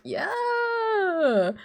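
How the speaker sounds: very high crying-laughing yeah; laughing awkward yeah. Retold or imitated. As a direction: squeak it out while laughing. Awkward reply to grandma.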